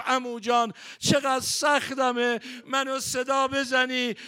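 loud, rising mourning sermon; preaching at full force. Acted. Preacher mourning Hussein to the crowd, loud and impassioned. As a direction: drive the lament with full voice.

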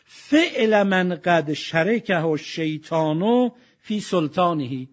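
slow, measured recitation; recited Arabic. Acted. Preacher reciting the hadith to listeners, slow intoning. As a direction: intone the Arabic slowly and clearly.

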